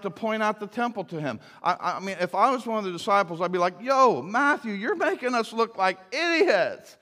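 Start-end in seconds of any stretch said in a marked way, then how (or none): 3.8-7.0 exasperated. Pretend. Imagines scolding a fellow disciple.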